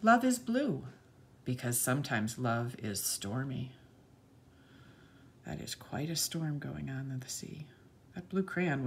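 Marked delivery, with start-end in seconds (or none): 0.0-3.7 put-on bright, sing-song read-aloud for children; lilting picture-book narration; storybook reading. A narrator's reading. 5.4-7.7 soft storytime whisper; hushed aside to the little ones. Acted.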